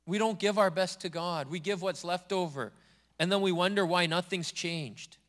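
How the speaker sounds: ordinary talk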